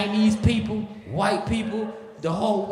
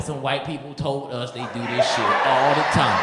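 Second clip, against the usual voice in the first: slow, laughing, pleading excuse; laughing plea; 3 semitones lower than usual. Retold or imitated. Explaining to the border official, slow laughing plea. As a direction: beg your way out, laughing.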